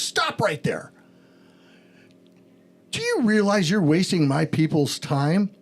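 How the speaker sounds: stern boss cutting in; a hint of a commanding supervisor; authoritative command. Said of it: "very authoritative command".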